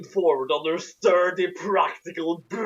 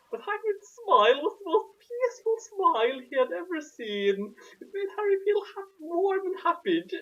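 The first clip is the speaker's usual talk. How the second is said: much higher, sugary mock rapture; gushing narration; 7 semitones higher than usual. Mocking the author's praise of Hagrid, much higher and gushing. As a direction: read it like a swooning fan.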